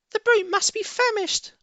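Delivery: very high, odd Bunce voice. Acted. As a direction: squeak it out impatiently.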